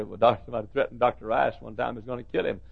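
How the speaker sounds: normal talking voice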